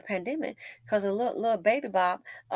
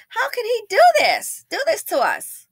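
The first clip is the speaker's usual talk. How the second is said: high, loud mock wail; imitated little girl; 14 semitones higher, 11 dB louder than usual. Girl protests about the owner, high mock wail. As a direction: whine it like a staged kid. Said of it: "she's like".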